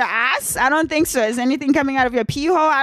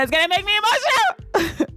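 high, cracking, about to cry; voice breaking; 11 semitones higher than usual. Real, not acted. New mom tearing up telling viewers about her support. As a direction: let the voice crack into tears. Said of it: "saying that I'm so emotional".

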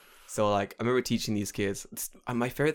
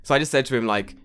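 ordinary talk

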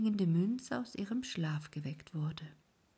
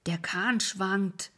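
put-on slow, louder warning from the old ferryman; the old boatman calls out, voiced over the whisper; slow ferryman's warning; old ferryman calling out; 6 dB louder, much slower than usual. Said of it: "rief der Alte".

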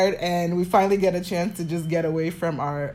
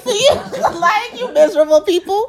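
mocking pity; 12 semitones higher, 6 dB louder than usual. Mocking the complainers.

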